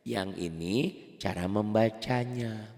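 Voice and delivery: ordinary talk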